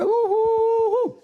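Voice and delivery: high, drawn-out mock wail "ouh"; mock howl. To the congregation about loneliness, a high mock howl.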